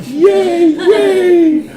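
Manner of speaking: very high, loud squeaky cheer with a laugh; little creatures cheering, very high and loud; tiny fans cheering. Acted. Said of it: "the two little fans sitting next to him are like".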